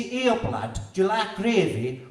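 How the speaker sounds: mock northern Yorkshire lad; bad Yorkshire accent. Poet doing a bad accent for laughs, mock Yorkshire. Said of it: "I canna do accents".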